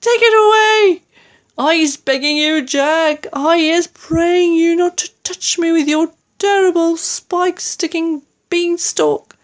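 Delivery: high, loud, desperate begging; panicked high-pitched wailing; screaming. A narrator's reading. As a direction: plead in terror as a giant in a nightmare, pitch way up. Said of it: "screamed the flesh lump eater".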